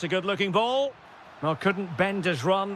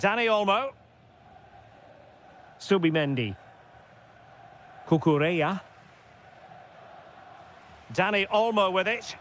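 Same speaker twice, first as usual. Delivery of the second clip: normal talking voice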